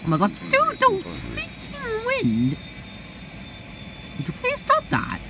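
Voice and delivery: very high, strained cry; exasperated high yell. Real, not acted. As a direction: shriek at the wind in frustration. Shouting at the wind in game.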